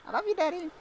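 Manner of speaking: put-on high, sweet kid saying goodbye; a small child's fond farewell; little child voice. Child saying goodbye to daddy over the radio, high and sweet.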